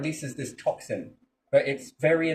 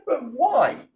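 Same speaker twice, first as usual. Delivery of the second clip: ordinary delivery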